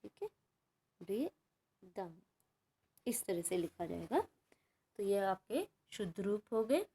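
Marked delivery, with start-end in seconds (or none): 1.0-2.1 मृदंग said slowly and softer; quiet slow second take of मृदंग; slow soft model word. Shown as a demonstration. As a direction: repeat मृदंग gently and slowly. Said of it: "ये री की sound देता है".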